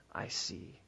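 dropped to a soft near-whisper; hushed. Acted. Preacher softly finishing the hymn line to the congregation, hushed. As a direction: let the last words fall away softly.

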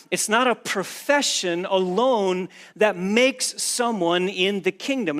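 normal talking voice

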